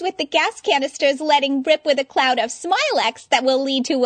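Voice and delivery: very high, loud zany showgirl; Harley voice. Acted. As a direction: play a zany high-pitched announcer. Harley Quinn addresses crowd.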